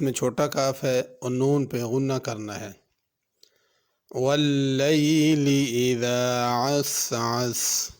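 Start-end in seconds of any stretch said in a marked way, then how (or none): 4.1-7.9 slow, drawn-out chanted recitation; measured melodic tilawat; Quran recitation. Shown as a demonstration. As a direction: recite it like the teacher modelling tajweed. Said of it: "teacher recites verse to model tajweed".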